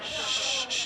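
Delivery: soft unvoiced shh; shushing. Real, not acted. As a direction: hush the room. Quieting a crowd of students.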